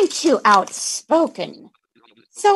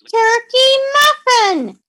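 higher, louder sing-song call to a dog; excited pet-talk call; 8 semitones higher, 4 dB louder than usual. Retold or imitated. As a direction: squeal the pet name at the dog. Owner calls the dog.

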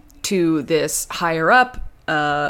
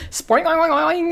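boing sounds; 12 semitones higher than usual. Pretend. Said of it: "just being like".

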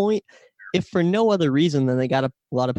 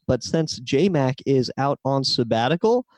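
ordinary talk

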